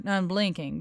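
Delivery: ordinary delivery, nothing marked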